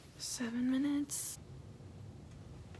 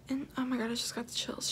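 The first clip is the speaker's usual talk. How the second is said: usual speaking voice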